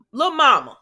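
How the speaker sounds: surprised loud outburst; sudden loud call. Real, not acted. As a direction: blurt the name out, loud and high. Calling out suddenly.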